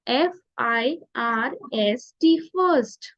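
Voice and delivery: एफ आई आर एस टी spelled slowly, letter by letter; slow teacher spelling. Shown as a demonstration. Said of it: "आई का sound होता है अ".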